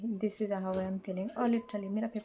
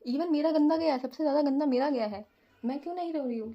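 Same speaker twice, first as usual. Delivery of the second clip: louder, higher bewildered outburst; exasperated; 4 semitones higher, 6 dB louder than usual. Real, not acted. Vlogger ranting to viewers about her bad exam, loud and bewildered. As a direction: rant at herself in disbelief. Own exam went worst.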